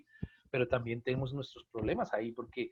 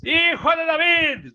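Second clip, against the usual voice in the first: much higher and louder, slow cry on Hijo de David; dramatic shout; 8 semitones higher, 8 dB louder, slower than usual. Shown as a demonstration. Priest demonstrates a lector voicing Bartimeo's loud cry. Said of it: "le ponen énfasis".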